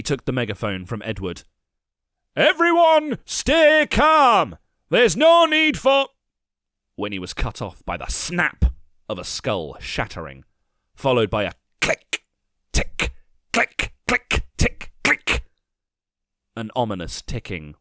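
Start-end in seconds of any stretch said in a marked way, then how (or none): 2.4-6.1 high, loud megaphone shout; shouted through megaphone. A narrator's reading. Commander calms crowd via megaphone. 10.9-15.4 ticking clicks. A narrator's reading. Ominous ticking sound.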